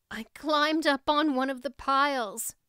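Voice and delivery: ordinary delivery, nothing marked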